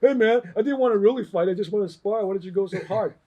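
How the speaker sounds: high, loud mock protest easing slightly; playing the victim. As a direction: plead innocence like the injured party. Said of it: "I play the victim and go".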